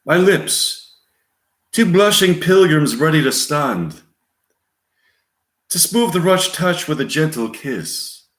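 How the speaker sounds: put-on high, theatrical English accent; lofty English-accented stage lover. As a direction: declaim Shakespeare in an English accent. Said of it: "male voice, English accent".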